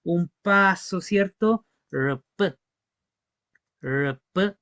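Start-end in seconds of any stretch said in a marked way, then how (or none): 1.9-2.6 spelled-out sounds pronounced slowly; teaching demo of a Mapuche word. Shown as a demonstration. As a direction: say it carefully like a teacher. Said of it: "también le pueden decir R P".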